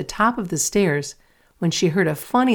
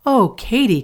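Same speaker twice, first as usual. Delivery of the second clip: dismayed cry; 6 semitones higher, 4 dB louder than usual. A narrator's reading. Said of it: "cried Mary".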